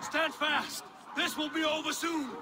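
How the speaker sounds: captain urging warriors to stand; battle command shout. Acted. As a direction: hold the line, call it out. Calling orders in battle.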